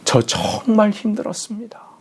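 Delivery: high breathy whisper, 정말 stretched; the son's final whispered confession to his father; whispered lament. Retold or imitated. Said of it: "토해내듯이 울 수밖에 없는".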